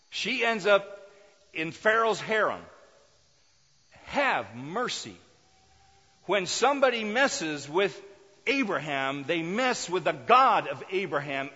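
loud, fervent sermon delivery; preaching at full force. Acted. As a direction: preach it out with fire. Preacher building up the story.

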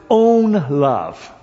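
"own" drawn out loud and high; stress on own. Shown as a demonstration. Preacher reading the verse to the congregation, emphasis on "own". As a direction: lean hard and long on "own". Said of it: "I like that emphasis on own love".

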